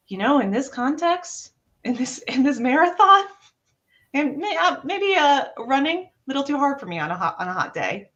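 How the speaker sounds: a light, kind inner voice making excuses; reasoning self-talk. Retold or imitated. Athlete telling herself it's okay on a hot marathon day, lightly voiced.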